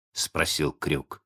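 ordinary delivery

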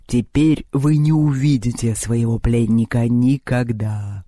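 slow, low villain voice; ominous bird leader. A narrator's reading. Bird leader gloating over the captive, ominous. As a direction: draw it out low and sinister. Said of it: "громко и зловеще сообщила другая птица".

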